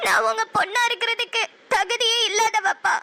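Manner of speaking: tearful confession. Acted. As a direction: beg his forgiveness through tears. Daughter confesses to father, crying.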